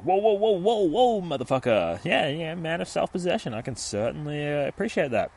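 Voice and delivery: hammed-up excited vendor. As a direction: play the vendor tickled by the big boy. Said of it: "the ice cream man's like".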